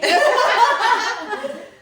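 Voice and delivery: high, loud burst of laughter; laughing. Real, not acted. Host laughing at a guest's confusion, high laughter. Joking about not knowing.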